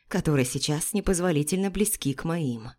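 ordinary delivery

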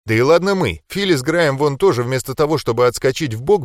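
usual speaking voice

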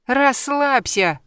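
high, stretched-out brush-off; dismissive snort. A narrator's reading. Friend waves off the heroine's job worries, snorting. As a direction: drag the word out dismissively. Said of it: "фыркнула Катя".

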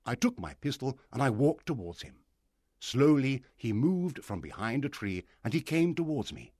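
ordinary delivery

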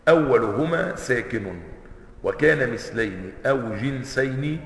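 soft, slow, measured recital of the verse; slow rule recitation. Shown as a demonstration. Teacher reciting the tajweed rule slowly.

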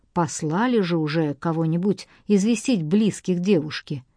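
usual speaking voice

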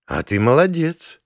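impressed, rising remark; surprised. A narrator's reading. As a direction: lift the pitch in mild surprise. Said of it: "удивлённо заявила Зоя".

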